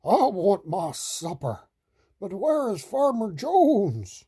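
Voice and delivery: horse voice. A narrator's reading. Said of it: "The horse stamps his".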